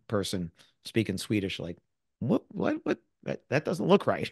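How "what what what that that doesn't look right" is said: high, baffled double take; mock surprise. Traveller puzzled by Swedish-speaking teens, high baffled. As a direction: play your own confusion at the sight.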